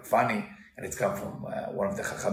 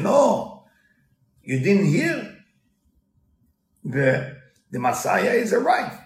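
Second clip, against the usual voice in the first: wolf announcing good news, loud and high; excited wolf; 7 semitones higher, 5 dB louder, slower than usual. Retold or imitated. Wolf tempts the chicken with Messiah news, eager.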